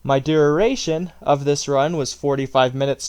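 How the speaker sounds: ordinary delivery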